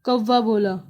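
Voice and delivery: plain reading voice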